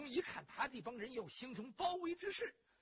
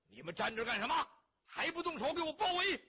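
barked order, building louder and higher; commanding shout; 5 semitones higher, 6 dB louder than usual. Acted. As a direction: yell the troops into action. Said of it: "就喊了一嗓子".

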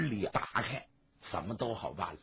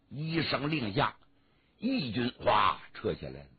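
ordinary delivery

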